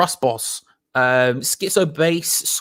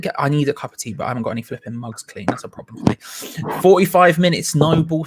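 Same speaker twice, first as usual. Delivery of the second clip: normal talking voice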